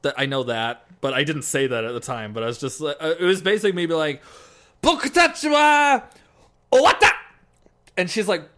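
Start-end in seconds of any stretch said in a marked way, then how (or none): 4.8-7.1 high, loud drunken Japanese attempt; japanese learner accent. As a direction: shout broken Japanese, tipsy. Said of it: "drunk trying to speak Japanese".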